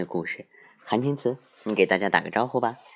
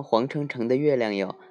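usual speaking voice

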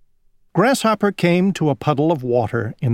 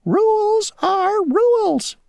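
very high, slow, stubborn tiny voice; stubborn mosquito; slower than usual. Acted. Mosquito refusing to bend the rule for Grasshopper, slow high insistence. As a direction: drag it out like a stubborn little insect.

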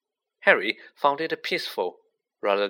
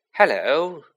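higher, louder happy greeting; pleased hello, pitched up; 4 semitones higher, 6 dB louder than usual. A narrator's reading. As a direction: greet them with mild delight. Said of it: "said Harry happily".

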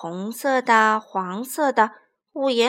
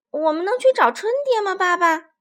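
put-on high, louder child's plea; eager little boy asking; 7 semitones higher, 6 dB louder than usual. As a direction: beg Dad to go out, much higher and louder. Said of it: "马库问".

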